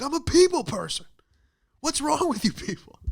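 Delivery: high, loud shouted rant of the Office Space guy. Retold or imitated. Mimicking the Office Space guy to the crowd, high and loud. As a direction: yell it like the unhinged office guy. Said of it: "like the guy from Office Space".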